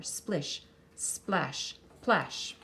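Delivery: usual speaking voice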